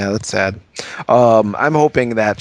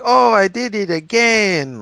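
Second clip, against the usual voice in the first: high, slow, drawn-out oopsie; mock cutesy catchphrase; 9 semitones higher than usual. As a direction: parody a game heroine's catchphrase, pitched up. Mimicking a character's catchphrase.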